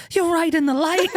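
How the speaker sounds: very high, insistent imitation; comically indignant friend; mock exasperation. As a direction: retort in high-pitched disbelief. Retold friend's exasperated retort.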